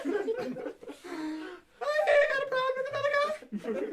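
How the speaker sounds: very high, silly put-on voice; mock caller. Playing the complainer to the fake supervisor, absurdly high mock voice. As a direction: squeak it out as a joke caller.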